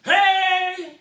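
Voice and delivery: sudden loud shout to scare; startling boo. Acted. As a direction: jump out and yell. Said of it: "Did I scare you?".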